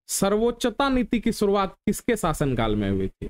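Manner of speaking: ordinary talk, nothing marked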